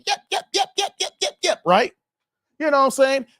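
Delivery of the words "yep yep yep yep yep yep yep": high, quick little yaps like a small dog; yapping dog. Retold or imitated. Storyteller imitating the barking ankle-biter dog, high yaps. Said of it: "The dog's like".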